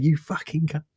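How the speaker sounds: a hammed-up furious rant at a teammate over the mic; retold raging insults. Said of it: "I'm going mental".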